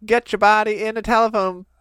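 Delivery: loud, high pep chant; cheerleader. Retold or imitated. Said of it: "a cheerleader that would be cheering them on".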